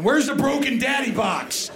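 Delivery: high, slow, bewildered asking; mock frantic search. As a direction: play lost and pleading in the dark. Himself searching inside her.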